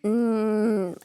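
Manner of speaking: ordinary talk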